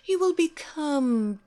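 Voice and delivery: slow, sultry villainess luring her victim; seductive hypnotist. Acted. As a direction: purr it like a temptress casting a spell.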